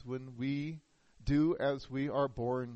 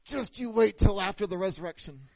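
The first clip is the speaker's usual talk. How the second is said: a mock threat through clenched teeth, louder than a real aside; muttered through teeth; 5 semitones higher, 6 dB louder than usual. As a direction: grit your teeth and growl the threat, half-joking. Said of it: "clenched his teeth, and said under his breath".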